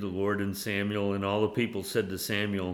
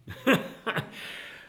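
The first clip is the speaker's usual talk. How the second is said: high burst of chuckling; laugh; 12 semitones higher than usual. Real, not acted. Preacher after quoting Paul on prayer, laughing. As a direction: break into a laugh.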